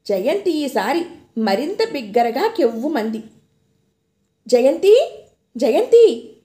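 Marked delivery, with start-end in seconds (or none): 4.5-6.3 calling her name out loud and urgent; husband calling his wife awake, anxious and loud. A narrator's reading. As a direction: wake her, worried, calling out. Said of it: "ఆదుర్దాగా పిలుస్తున్నాడు".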